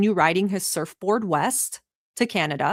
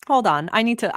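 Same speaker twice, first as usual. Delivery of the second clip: ordinary talk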